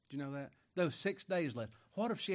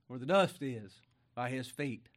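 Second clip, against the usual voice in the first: usual speaking voice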